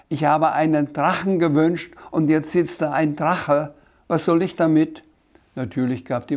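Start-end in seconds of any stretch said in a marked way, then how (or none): 0.1-5.0 laute, leicht empörte Beschwerde; empörtes Rufen. A narrator's reading. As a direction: sich lautstark bei der Maschine beschweren. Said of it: "rief der Sams zur Wunschmaschine hoch". (vorwurfsvoll gerufen)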